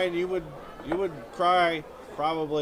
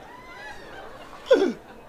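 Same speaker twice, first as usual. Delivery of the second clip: very high choked mock sob; hammed-up sob cough. Collapsing on the homeless guy, choked mock sob.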